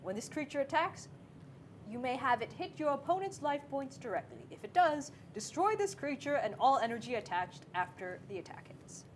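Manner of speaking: tree voice for reading the card; talking tree. Pretend. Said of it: "This is my tree voice".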